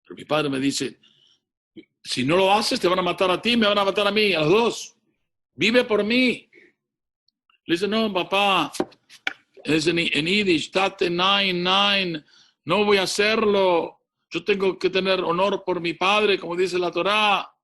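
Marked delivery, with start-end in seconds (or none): 2.0-6.5 higher, louder desperate pleading; urgent plea. Retold or imitated. Father begging his son to save himself, loud urgent plea. 7.9-17.5 higher, louder anguished refusal; pleading refusal. Retold or imitated. Boy refusing his father in Yiddish, loud and anguished.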